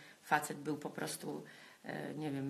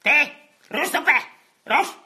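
harsh yelling; 3 semitones higher, 14 dB louder than usual. Shown as a demonstration. What not to shout at a depressed person, loud angry yell. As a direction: yell it at someone to snap out of it. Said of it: "Nie wolno mówić do osoby z depresją".

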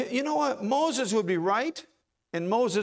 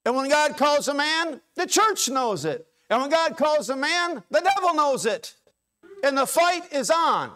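high, loud preaching refrain; preacher driving home that the devil knows it; full-force preaching; 8 semitones higher, 5 dB louder than usual. Acted. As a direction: build it like a revival sermon, every line a hammer.

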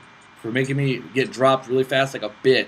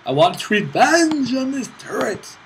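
higher, louder, slowed revenge vow; hammed-up vengeful cry; 9 semitones higher, 5 dB louder, slower than usual. Real, not acted. Gamer vowing revenge on the turret, hammed up. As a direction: ham up the revenge line.

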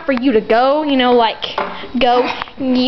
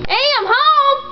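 calling out; 11 semitones higher than usual. Acted. Calling out on arriving home.

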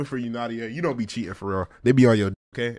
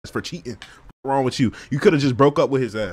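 usual speaking voice

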